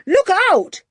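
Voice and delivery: high, loud sudden warning yell. Acted. Julian yells a warning as Ebenezer rushes at Jeremiah. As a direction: warn someone of a blow coming. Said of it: "yelled Julian".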